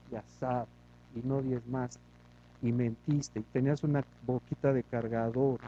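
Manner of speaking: lightly voiced judgment, low and hushed; mild stern accuser, low and muted; low stern judge. The Lord lists the brother's sins.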